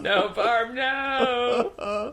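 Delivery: mock mom protest. Mocking mom's protest, high.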